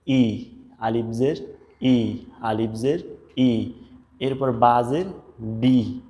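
"ই" and "বি" stretched out slowly; slow recitation of Arabic letters; slow letter drill. Shown as a demonstration. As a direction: drill each sound slowly for students. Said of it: "আলিফকে জের মিলায় পড়ব".

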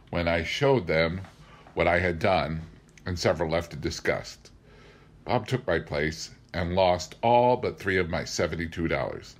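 ordinary delivery

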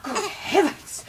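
alarmed cry. Acted. Jane alarmed to see the boy holding the baby. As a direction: gasp it out in alarm.

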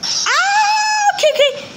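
screaming as it lunges; panicked shout. Real, not acted. Chased by the piggy.

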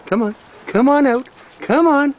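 high sing-song coaxing, rising; coaxing call. Real, not acted. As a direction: call the puppy out sweetly. Calling dog out, rising pitch.

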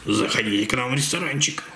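low, creepy zombie host. Pretend. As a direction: lure guests in like a zombie. Voicing the zombie restaurant manager.

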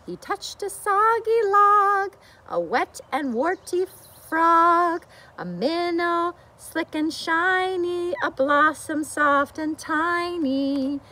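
lively storybook reading; reading to children. A narrator's reading. Teacher reads the bear book to children, storybook voice. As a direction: read it out for a room of little ones.

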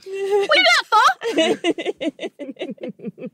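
high, drawn-out Cockney put-on; mock Cockney. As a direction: play at Cockney, slow and high. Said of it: "I would love to have a Cockney accent".

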